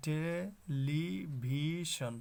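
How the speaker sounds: টেলিভিশন stretched very slowly into te, li, bhi, shon; teacher's slow, separated syllables on টেলিভিশন. Shown as a demonstration. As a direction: draw out all four syllables of টেলিভিশন. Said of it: "চারটি syllable আছে".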